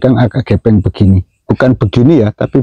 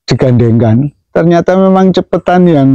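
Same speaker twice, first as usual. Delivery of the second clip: ordinary talk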